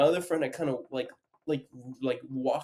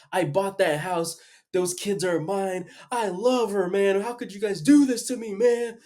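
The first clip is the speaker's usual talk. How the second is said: mock belligerent; 6 semitones higher, 4 dB louder than usual. Drunk man accuses strangers of betraying him, mock belligerent. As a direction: imitate a belligerent drunk pleading his case. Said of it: "he's getting belligerent".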